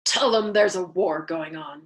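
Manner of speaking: cold, dismissive, deliberate; flat voice. A narrator's reading. Colonel answering the chaplain's plea about missions, flat and cold. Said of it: "he advised finally in a flat voice".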